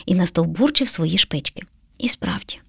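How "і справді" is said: low, softened murmur; musing privately, under the voice; thinking to oneself. A narrator's reading. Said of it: "подумала мишеня".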